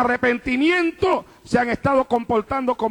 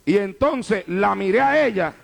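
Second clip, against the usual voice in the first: normal talking voice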